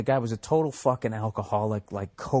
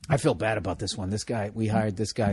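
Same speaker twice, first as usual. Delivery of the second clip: ordinary talk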